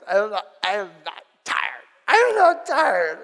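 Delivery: hammed-up crying child insisting she's not tired; tearful protest. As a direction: whine it like a four-year-old in tears. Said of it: "she was in tears".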